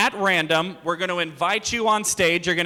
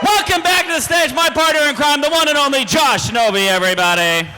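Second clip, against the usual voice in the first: loud, high stage announcer; announcer intro; 7 semitones higher, 5 dB louder than usual. Real, not acted. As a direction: bring him on like a ringmaster. Host introduces partner on stage.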